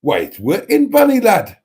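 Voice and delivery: high, loud go at a Geordie accent. Shown as a demonstration. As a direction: belt it out in amateur Geordie. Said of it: "Shall I have a go at one?".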